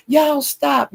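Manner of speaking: high pleading stop; imitating Jackie. Retold peacemaker, Jackie.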